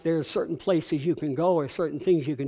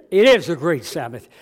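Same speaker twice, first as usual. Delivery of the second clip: preaching; 5 dB louder than usual. Acted. Preaching to congregation.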